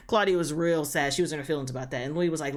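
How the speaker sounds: usual speaking voice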